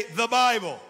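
loud, forceful prompt to a crowd; rallying shout for the congregation to echo; like you mean it. Shown as a demonstration. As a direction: belt it out with conviction. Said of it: "Say it like you mean it".

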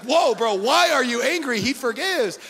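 loud, startled bro reaction; half-joking pushback at the shouting; taken-aback friend. Retold or imitated.

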